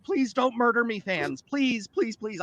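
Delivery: lightly acted anxious plea to fans; mock pleading. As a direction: beg for mercy, half-joking. Pleading with fans in his mind.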